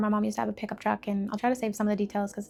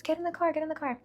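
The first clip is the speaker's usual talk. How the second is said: high urgent order from mom; mother urging her crying child; 7 semitones higher, 3 dB quieter, faster than usual. Retold or imitated. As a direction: hurry the child into the car. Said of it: "she says".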